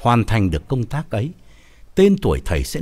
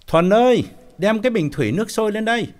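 raised voice, fast call; 11 semitones higher than usual. Acted. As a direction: call down to the kitchen. Calling maid from kitchen.

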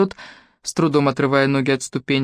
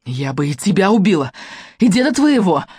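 unstoppable rage; 3 semitones higher than usual. A narrator's reading. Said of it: "Томшу несло".

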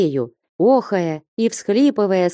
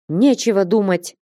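plain reading voice

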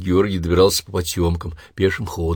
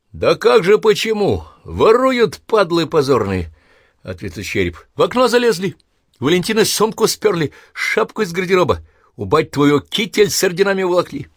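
indignant; 10 semitones higher than usual. Acted. Skull indignant about thieves.

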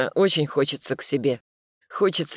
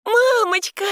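put-on child's joyful shout, much higher and louder; little girl shouting; 6 dB louder than usual. A narrator's reading. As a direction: squeal for mommy like a small girl. Little daughter runs shouting.

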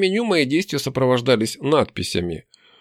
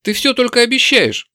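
high-pitched annoyed reproach; irritated; 10 semitones higher, 4 dB louder than usual. A narrator's reading. Demon scolding the hero for empty promises, irritated. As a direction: complain in a sharply higher pitch. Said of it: "заявила она раздражённо".

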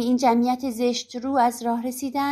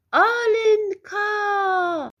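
long, drawn-out roar, much higher and louder; bellowing a name; 8 semitones higher, 4 dB louder, much slower than usual. Acted. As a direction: call her name with a witch's roar.

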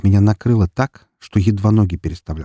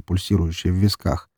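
ordinary delivery, nothing marked

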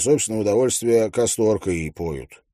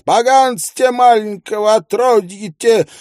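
high squealing peasant shriek; squealing milkmaid; 10 semitones higher, 5 dB louder than usual. A narrator's reading. Milkmaid screams abuse about the child, high squeal. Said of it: "визжит скотница".

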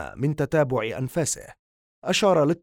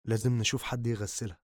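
much softer, low and gentle; 3 semitones lower, 7 dB quieter than usual. Acted. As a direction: say it softly, consoling. Friend gently consoles grieving man.

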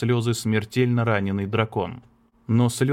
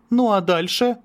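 pushing, much higher rising demand; insistent question; 11 semitones higher, 4 dB louder than usual. Acted. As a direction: keep pressing her. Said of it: "не унимался Мартин". (настойчиво допытываясь)

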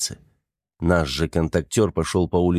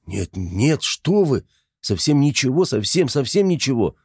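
high, pleading denial; frantic denial; 6 semitones higher than usual. A narrator's reading. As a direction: wave it off in panic. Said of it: "замахал руками журналист".

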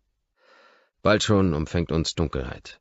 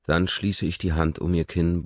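ordinary delivery

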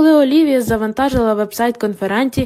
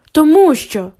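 blurted; 5 semitones higher, 5 dB louder, slower than usual. Acted. Olivia blurting defensively.

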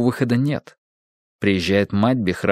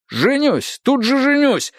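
high, eager shout of joy; excited exclaim; 13 semitones higher than usual. Acted. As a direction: blurt it out overjoyed. Шах радостно восклицает. (радостный возглас)

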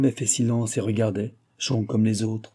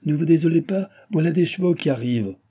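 high, raised call of reassurance; crying out; 7 semitones higher, 4 dB louder than usual. A narrator's reading. Postmaster reassures impatient traveller about horses, crying out. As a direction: call out high to placate him. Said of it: "cria le maître de poste".

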